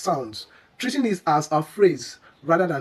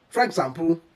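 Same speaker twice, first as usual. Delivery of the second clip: normal talking voice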